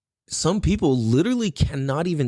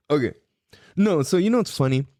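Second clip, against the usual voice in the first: ordinary talk, nothing marked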